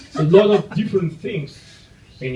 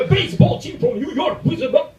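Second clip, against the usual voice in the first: mock news anchor; 12 semitones higher than usual. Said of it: "I expect the anchor to snatch a mosquito".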